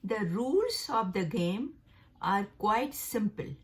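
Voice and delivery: each word of the sentence read slowly; teacher reading the third example. Shown as a demonstration. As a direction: dictate it slowly for learners. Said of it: "तीसरा जुमला है".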